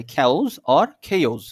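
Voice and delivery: slow Chaos as "khaus", then "khe-oz"; two pronunciations. Shown as a demonstration. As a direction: contrast both sayings of Chaos, "khaus" or "khe-oz". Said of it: "দুইভাবে বলা যায়".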